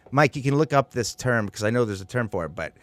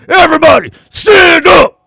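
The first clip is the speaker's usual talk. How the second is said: barked order, much higher and louder; drill sergeant yell; 13 dB louder than usual. Retold or imitated. Bus driver ordering kids to stand, drill sergeant yell. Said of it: "he would turn around and goes".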